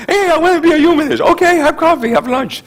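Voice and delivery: lightly voiced cheerful newcomer; mock welcomer. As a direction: play the eager greeter, high and quick.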